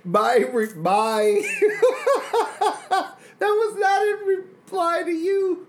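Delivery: high shouted laughing words; laughing hysterically. Real, not acted. Giggling fit after joke.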